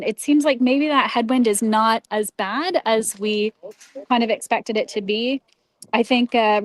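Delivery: ordinary delivery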